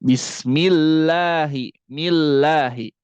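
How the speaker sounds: "bismillahi" slow, l going straight into lam, no nasal; correct bismillahi. Shown as a demonstration. Teacher showing the right "bismillahi", slow and clear. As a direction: say "millahi" slowly with a clean doubled l. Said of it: "bukan idgham bi gunnah".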